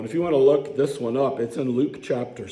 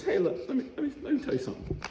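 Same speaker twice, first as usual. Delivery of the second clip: voicing Jesus; 11 semitones higher, 6 dB quieter, much faster than usual. Jesus addressing Simon, voiced.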